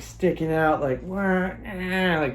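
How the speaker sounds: ordinary delivery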